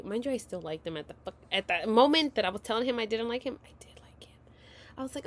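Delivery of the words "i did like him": barely audible aside; whispered confession. Real, not acted. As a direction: whisper it like a secret admission.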